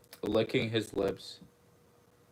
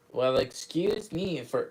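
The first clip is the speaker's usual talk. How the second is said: higher pitched, put-on character voice; odd put-on voice; 5 semitones higher than usual. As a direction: read the line in a strange affected voice. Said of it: "why did I do it in that voice?".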